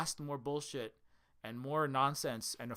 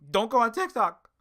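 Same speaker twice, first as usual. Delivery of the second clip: mock order; 7 semitones higher, 10 dB louder than usual. Mocking Western authorities.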